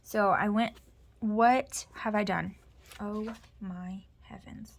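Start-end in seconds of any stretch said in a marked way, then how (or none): 3.0-4.7 breathless whisper, slowed down; whispered dismay. Real, not acted. Aside to herself over a mistake, whispered. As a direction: let the words slip out as a slow whisper.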